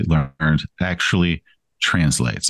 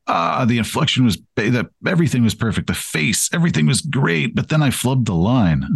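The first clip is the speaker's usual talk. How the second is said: higher-pitched mock lament; exasperated self-review; 5 semitones higher than usual. Actor reviewing his own takes, in mock exasperation. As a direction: bemoan a nearly perfect take, voice pitched up. Acting out reaction to a flubbed take.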